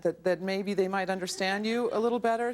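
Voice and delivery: ordinary talk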